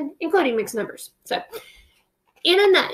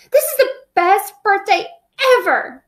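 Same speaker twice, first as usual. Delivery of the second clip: put-on excited boy; 8 semitones higher, 5 dB louder than usual. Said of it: "Peter said".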